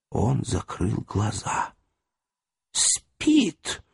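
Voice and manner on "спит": usual speaking voice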